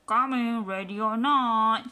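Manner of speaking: loud, slow playful call. Acted. Lion cub calls to hiding friends, loud and slow. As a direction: call out like seeking in hide and seek.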